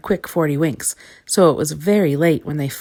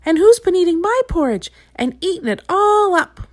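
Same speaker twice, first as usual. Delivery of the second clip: much higher, squeaky little bear; tiny squeaky voice; 13 semitones higher, 5 dB louder than usual. A narrator's reading. Little Bear finding his bowl empty, tiny squeaky voice. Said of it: "tiny, squeaky voice".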